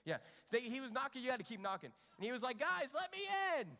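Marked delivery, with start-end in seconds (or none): 2.6-3.7 raised voice calling through a door, pitched up. Retold or imitated. Peter at the disciples' door at night, calling out loudly. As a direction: call to be let in, loud and high.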